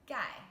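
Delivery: 盖 said as a sharp falling gài; teacher showing viewers the fourth tone on 盖, crisp drop; fourth tone demo. Shown as a demonstration. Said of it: "it's a fourth tone".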